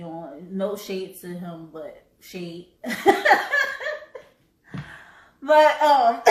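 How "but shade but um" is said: laughing. Real, not acted. Joking jab at Vlad TV.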